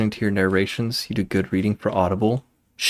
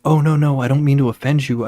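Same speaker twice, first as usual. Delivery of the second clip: imitating a hasty, higher and louder apology; mock-alarmed reassurance, pitched up; apologetic backpedal; 6 semitones higher, 6 dB louder than usual. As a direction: retell a customer rushing to take it back. Retold customer hastily apologizing after offense.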